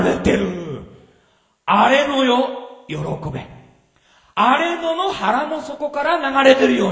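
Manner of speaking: 声を張り上げて高らかに説く; 会衆を鼓舞するように力強く; 熱のこもった説教. Acted. Loud preaching. (会衆に向かって荒れ野の喜びを説く、熱く高らかに)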